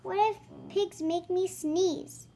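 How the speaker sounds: usual speaking voice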